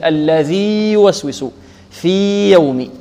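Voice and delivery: slow الذي يوسوس and في يوم, long ee kept apart from y; unmerged, drawn-out الذي يوسوس; clear separation. Shown as a demonstration. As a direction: stretch في before يوم. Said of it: "يعني أظهر".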